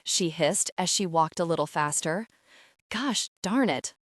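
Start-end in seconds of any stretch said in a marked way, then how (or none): none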